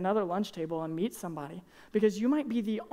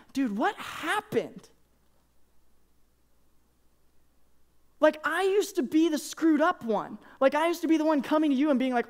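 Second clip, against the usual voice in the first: put-on high, loud, anguished plea to an old friend; pleading outburst; 6 semitones higher, 4 dB louder than usual. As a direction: cry out at him, desperate and hurt. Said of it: "every part of me wants to go".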